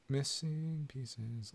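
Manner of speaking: very slow, quiet, stretched out; slow drawn-out repeat. Shown as a demonstration. Streamer repeats the title for chat, slow and hushed. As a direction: draw out "Missing pieces" slowly and softly.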